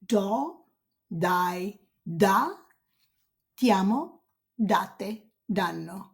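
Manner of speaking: each form of dare said slowly and clearly in Italian; Italian conjugation demo. Shown as a demonstration. Teacher modelling dare forms for students.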